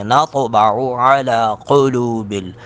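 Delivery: slow drawn-out melodic tilawat; Quran recitation. Acted. Teacher reciting the verse aloud, melodic. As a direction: chant it long and melodic.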